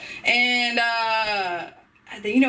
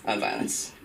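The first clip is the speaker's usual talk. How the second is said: quiet aside; 8 dB quieter than usual. Real, not acted. Muttered aside about joke.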